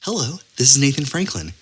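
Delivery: normal talking voice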